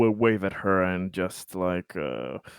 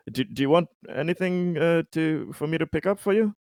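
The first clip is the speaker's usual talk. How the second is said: mild mock call; 8 semitones higher, faster than usual. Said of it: "He kind of shouts over the distance".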